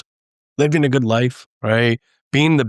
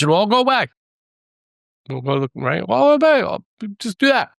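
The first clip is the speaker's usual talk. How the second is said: mocking; 9 semitones higher than usual. Mocking the quick-fix promise.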